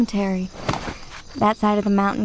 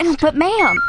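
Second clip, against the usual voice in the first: higher, louder objection; pleading protest; 6 semitones higher, 6 dB louder than usual. Acted. Employee to boss closing her inquiry, pleading protest. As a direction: push back urgently at being shut down.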